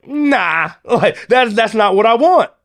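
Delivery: high-pitched, incredulous turn-down; emphatic refusal. Retold or imitated. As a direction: act out rejecting the offer, voice jumping up. Acting out his refusal of job.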